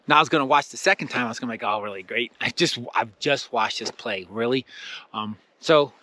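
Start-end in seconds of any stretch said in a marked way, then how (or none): 1.7-4.7 low, softer grumble of mock resignation; deflated, as if stuck watching it all again; unenthused. Student stuck seeing the play again, low unenthused grumble. Retold dread of repeat viewing.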